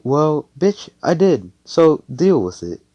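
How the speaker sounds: loud, high, defiant retort; sassy comeback. Acted. Noelle talking back to Magna about his ride, sassy. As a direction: throw it back with acted attitude.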